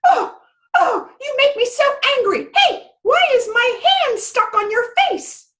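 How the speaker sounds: fly into a squeaky little rage; fairy temper tantrum. Acted. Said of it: "fairies can have quite a temper".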